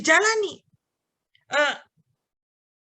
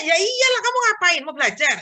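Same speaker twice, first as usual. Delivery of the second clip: exasperated retort; 4 semitones higher than usual. Retold or imitated. Uncle retorts to whining niece.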